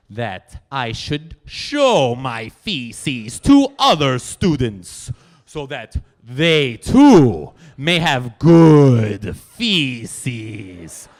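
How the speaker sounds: put-on drawn-out loud Polish-accented boast; grand Polish lady. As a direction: stretch it out like a proclamation. Monika continues grand boast.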